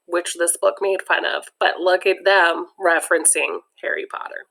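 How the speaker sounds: ordinary delivery, nothing marked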